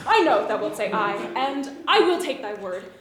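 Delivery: ordinary talk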